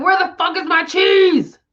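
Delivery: high, exasperated mock complaint; frustrated whine. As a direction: whine like a lost mouse. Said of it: "you're like".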